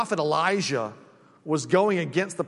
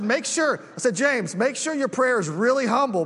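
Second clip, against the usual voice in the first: higher, louder mock-stern order; mock stern warning; 6 semitones higher, 3 dB louder than usual. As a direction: play the pastor scolding half in jest. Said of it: "I told him".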